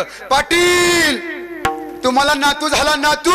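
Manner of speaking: high, long-drawn public holler; shouted announcement. Acted. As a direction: holler the good news across the village. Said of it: "ओरडून सांगितलं". (ओरडून जाहीर करत)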